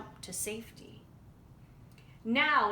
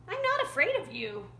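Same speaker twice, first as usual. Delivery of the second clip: defiant; 9 semitones higher, 4 dB louder than usual. A narrator's reading. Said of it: "Roadrunner replied".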